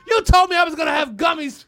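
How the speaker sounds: high, shouted demand; cartoonish boss outburst; mock angry boss. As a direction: rant at an underling, hammed up. Acting the boss berating Brett.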